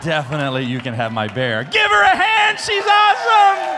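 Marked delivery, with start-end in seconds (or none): 1.6-3.8 high, loud emcee holler; cheering shout. Real, not acted. Preacher getting applause for excited child, cheering shout. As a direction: whip up applause with a big shout.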